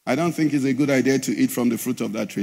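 understated mock-polite advice; mild casual suggestion. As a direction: toss it off as a gentle suggestion, not an order. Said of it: "He didn't just say to them".

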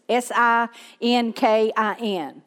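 spelling SINKIN letter by letter; spelled out. Shown as a demonstration. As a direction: say each letter of S I N K I N separately. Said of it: "spelling it for you".

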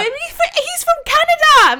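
very high, loud imitation of scandalised co-workers; shocked outcry. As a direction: squeal it in mock disbelief. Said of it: "They were, like, shocked".

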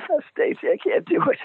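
very high, mock-whiny squeak; squeaky, helpless little plea; whimpering cop-out. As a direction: imitate yourself chickening out to a girlfriend. Retells wimping out to girlfriend.

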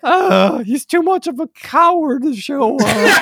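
high nasal nerd voice; nasally voice. Retold or imitated. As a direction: whine it through the nose. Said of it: "like, nasally voice".

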